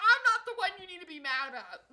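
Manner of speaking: loud, high and fast comeback; arguing with the angry man; indignant retort. Retold or imitated. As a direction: fire it back at him. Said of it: "I was like".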